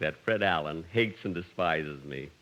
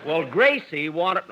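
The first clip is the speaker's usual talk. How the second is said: normal talking voice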